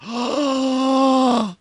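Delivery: long, high drawn-in gasp; exaggerated gasp. Co-caster reacts to the raffle finalists, long exaggerated gasp.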